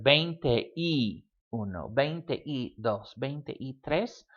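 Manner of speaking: slow veinte y uno, veinte y dos, with y standing alone; separate-word pattern. Shown as a demonstration. Teacher showing the first pattern, slow separated words. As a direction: count slowly, keeping veinte, y and each digit apart. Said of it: "This first pattern that you see".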